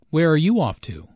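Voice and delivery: "Where are you off to?" falling at the end; information-question fall on "off to"; falling intonation. Shown as a demonstration. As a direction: let "off to" drop in pitch, as an information question. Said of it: "Notice the falling intonation".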